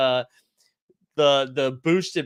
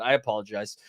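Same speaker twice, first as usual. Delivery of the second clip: ordinary talk, nothing marked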